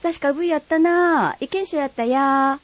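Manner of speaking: high, lively Kagoshima dialect neighbour. Acted. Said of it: "鹿児島弁は".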